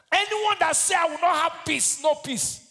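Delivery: high, loud, shouted sermon; rally the congregation with every line; preaching at full force. Acted.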